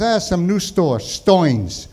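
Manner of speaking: broad Brooklynese, ER as OY; Brooklyn accent. Retold or imitated. Uncle naming the new store, Brooklyn accent. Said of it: "He spoke with a Brooklyn accent".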